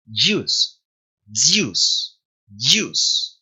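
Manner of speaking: "juice" begun with a soft d gliding into y; "juice" as dyoos, d slightly overlapped; soft dy onset. Shown as a demonstration. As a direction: model "juice" slowly with a buzzy English J. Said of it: "como una D Y ahí medio solapadita".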